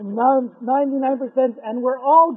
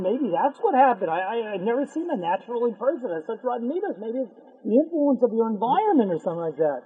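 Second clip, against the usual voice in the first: ordinary delivery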